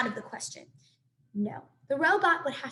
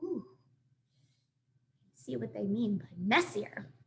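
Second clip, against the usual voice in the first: ordinary delivery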